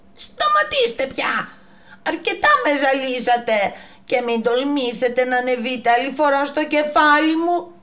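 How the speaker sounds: high, loud furious giraffe; angry shout. Acted. Giraffe yelling at the monkeys to stop, high and loud. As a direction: scold the pests off her neck. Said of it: "τους φώναξε".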